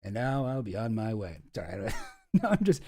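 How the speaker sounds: ordinary delivery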